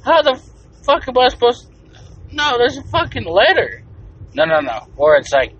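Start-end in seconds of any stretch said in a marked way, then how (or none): 0.0-3.8 high, loud, baffled outburst; exasperated disbelief. Retold or imitated. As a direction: blurt it out as if math makes no sense. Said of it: "I'm like".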